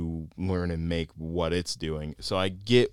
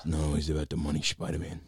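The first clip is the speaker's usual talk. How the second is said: calm menacing drug lord; 3 semitones lower, 4 dB quieter than usual. Retold or imitated. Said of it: "I loved the Kingpin voice".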